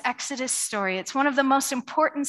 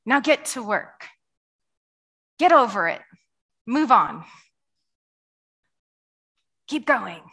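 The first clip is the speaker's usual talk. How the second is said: Pharaoh voice; 4 dB louder than usual. Retold or imitated. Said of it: "And in verse eighteen".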